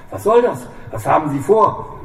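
high, frightened outcry; alarmed. A narrator's reading. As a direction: recoil from the gun.

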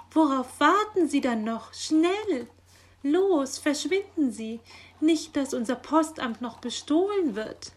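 high, loud, excited commands; agitated urging. A narrator's reading. Magpie hurrying the raven to the post office, agitated. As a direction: shoo him off in alarm. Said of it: "wedelte aufgeregt mit den Flügeln".

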